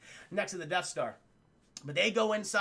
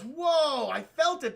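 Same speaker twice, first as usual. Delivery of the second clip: retold amazement; 6 semitones higher, 4 dB louder, slower than usual. Retold amazed reaction to success.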